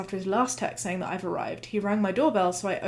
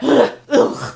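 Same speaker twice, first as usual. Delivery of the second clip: loud, higher grunts of effort; treadmill grunts; 5 semitones higher, 12 dB louder than usual. Retold or imitated. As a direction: grunt hard, as if slogging on the treadmill. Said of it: "I'd be like".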